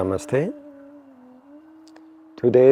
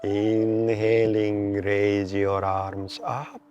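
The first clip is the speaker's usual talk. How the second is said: slow, measured meditation guide with long pauses; guided meditation; 5 dB quieter, slower than usual. Real, not acted. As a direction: draw out inhale and exhale cues to pace the breath. Leads a breathing meditation.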